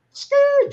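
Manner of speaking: high, loud tyre screech; sudden squealing car noise; imitated car peeling off. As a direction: screech like tyres speeding away. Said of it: "driving off and everything like that".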